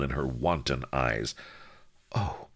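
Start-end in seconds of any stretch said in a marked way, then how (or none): 2.1-2.5 lightly husky, hushed exclamation; husky murmur. A narrator's reading. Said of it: "she murmured huskily".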